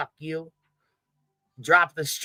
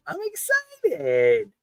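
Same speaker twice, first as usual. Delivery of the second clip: high, slow, put-on sarcastic excitement; mock excitement; much slower than usual. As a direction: stretch it out in a fake high cheery voice. Sarcastic reply to commenter.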